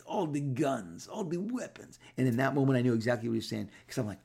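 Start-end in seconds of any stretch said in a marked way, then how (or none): 0.1-2.0 put-on lightly done heavy foreign accent, high finish; a hint of a thick foreign accent; Avi Arad heavy accent. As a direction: let the accent ride up on weapons. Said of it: "He talks heavy accent".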